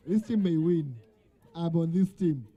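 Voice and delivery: normal talking voice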